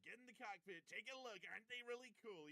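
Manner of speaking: playfully voicing Putin showing off the jet; mock Putin host. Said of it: "he could be like".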